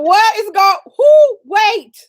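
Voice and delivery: loud, high startled holler; retold holler. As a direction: holler it in mock confusion. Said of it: "I just holler".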